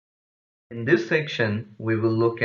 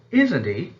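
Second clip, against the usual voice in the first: "isn't he" higher, swinging up at the end; rising tag question; 5 semitones higher, 4 dB louder than usual. Shown as a demonstration. Teacher models a tag question, rising tone. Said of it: "tag questions where the speaker".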